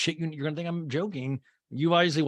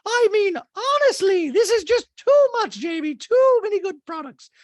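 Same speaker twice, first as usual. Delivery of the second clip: Dorit voice; 8 dB louder than usual. Retold or imitated. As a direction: gush it in a high, loud mock-posh voice. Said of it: "She's like".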